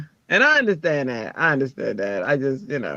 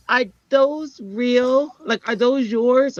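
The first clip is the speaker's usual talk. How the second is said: put-on higher, slow kid's question; imitated curious child; 7 semitones higher, slower than usual. Little Pebbles questions a lady at the pool, curious child. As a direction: ask it with a child's wide-open wonder.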